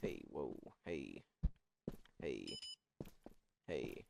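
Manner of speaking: very quiet, low little calls; hushed exclamations. Real, not acted. Player reacting during a game fight, hushed and low. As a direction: drop right down under your breath.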